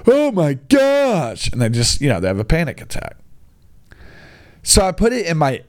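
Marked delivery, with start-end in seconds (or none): 0.1-1.5 mock horror. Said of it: "they're like".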